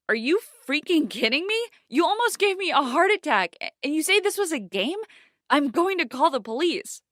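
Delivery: high, loud furious yelling; angry yell. A narrator's reading. Girl yelling at the prankster, high and furious. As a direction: tear into him. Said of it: "I yelled at him".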